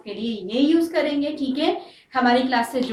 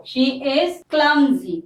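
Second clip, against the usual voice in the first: slow dictation; much slower than usual. Shown as a demonstration. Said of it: "teacher dictating example sentence".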